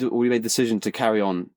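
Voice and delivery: normal talking voice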